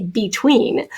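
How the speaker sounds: "between" sounded out as BEE-TWEEN; both syllables of "between" given a full long E; emphasized long E. Shown as a demonstration. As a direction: stress the "bee" in "between" for spelling. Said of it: "we can emphasize it".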